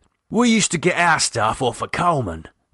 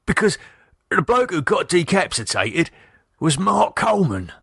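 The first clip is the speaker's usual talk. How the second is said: nervous; 8 semitones higher than usual. A narrator's reading. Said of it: "Keith began nervously".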